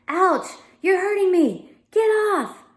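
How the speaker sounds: high, loud cry of pain and panic; panicked cry. A narrator's reading. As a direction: struggle free while shrieking. Girl grabbed, struggling against captor.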